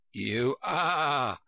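drawn-out sheep character; sheep's retort. A narrator's reading. Sheep contradicts Alice about being a bird, character retort.

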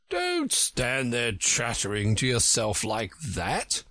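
slow, drawn-out scolding; pompous egg character telling off a child, slowly; haughty Humpty Dumpty, scolding. Acted.

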